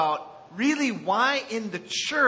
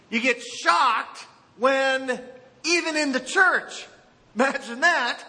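lifted, higher preaching voice building to emphasis; 6 semitones higher than usual. Acted. Pastor urging new churchgoers that conflict will come, rising preaching voice. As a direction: lean in and preach it to newcomers.

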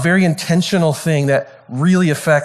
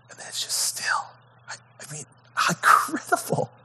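hushed, breathless whisper of wonder; awed whisper; 2 semitones higher, 9 dB quieter than usual. Real, not acted. Preacher marvelling at the sudden stillness, awed whisper. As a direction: let the silence after the storm sink in. Awe after storm stills.

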